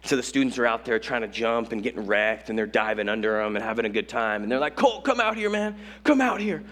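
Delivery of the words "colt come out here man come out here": higher-pitched mock call to a friend. Students urging their youth leader into the waves, calling out. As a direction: imitate someone calling a buddy over.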